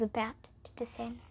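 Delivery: ominous hushed whisper. Acted. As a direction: breathe it out like a threat. Ominous story ending whisper.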